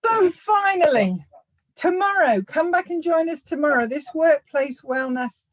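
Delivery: big emphatic return to business; loud announcement. Real, not acted. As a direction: cut through the laughter, pitched right up. Talks over laughter to resume announcement.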